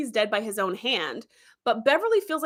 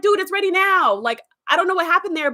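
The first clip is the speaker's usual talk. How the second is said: higher, louder pleading insistence; insistent protest; 7 semitones higher, 4 dB louder than usual. Retold or imitated. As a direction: push back hard, desperate to be believed. Scientist protesting rejection.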